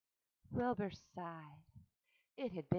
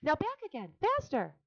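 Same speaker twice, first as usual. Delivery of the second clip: high, louder coaching shout; urging; 10 semitones higher, 7 dB louder than usual. A narrator's reading. Charlotte coaching Wilbur, high and loud. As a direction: push him faster. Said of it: "said Charlotte".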